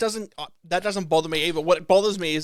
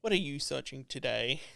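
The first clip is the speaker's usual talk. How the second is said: quiet computer voice, much softer; Hal; 3 semitones lower, 10 dB quieter than usual. Acted. Computer asking its owner about searches, hushed machine voice. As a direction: ask it softly, like a watchful machine. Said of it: "it's gonna be Hal's voice".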